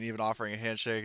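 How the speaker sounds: normal talking voice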